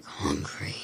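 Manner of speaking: very low, rasping monster; dark, drawn-out creature rasp; creepy symbiote voice. Retold or imitated. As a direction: growl it like a hungry creature. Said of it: "with the creepy voice".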